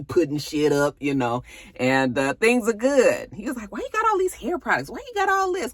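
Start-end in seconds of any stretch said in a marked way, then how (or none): 3.7-5.7 very high, fast whine; imitating a whiny boyfriend; mock complaining. Gordon complaining about her stuff.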